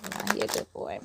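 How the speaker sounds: breathy whispered afterthought; whispered aside. Real, not acted. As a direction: drop to a whisper.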